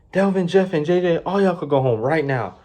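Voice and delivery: a hint of a high boss calling them off; mimicking manager. Manager telling Delvin and crew to go home, lightly mimicked. Manager dismissing workers early.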